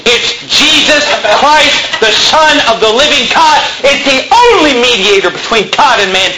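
high, emphatic preaching peak; preaching at full force. Real, not acted. Preaching climax.

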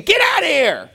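high-pitched angry yelling; exasperated, high shouted rant; mad outburst. Acted. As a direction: yell at them to leave, outraged. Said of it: "he's just mad".

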